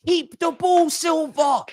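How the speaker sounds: high-pitched yell at a player; exasperated shout. Real, not acted. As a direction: shout it high, at your wits' end. Yelling at player to keep ball.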